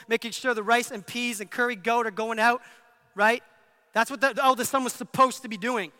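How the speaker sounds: ordinary talk, nothing marked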